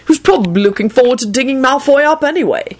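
high, lightly amused lilt; chuckling. A narrator's reading. Man musing about his absent friend, lightly chuckling. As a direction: let the pitch climb with mild amusement. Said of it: "he chuckled".

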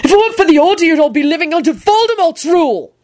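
high, impassioned declaration; angry yelling. A narrator's reading. Neville lecturing the warlocks about the Order, yelled. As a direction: rant at them, on a roll. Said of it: "yelled Neville".

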